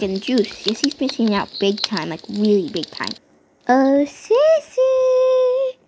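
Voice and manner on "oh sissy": high drawn-out call of the name. Real, not acted. Calling out to Sissy.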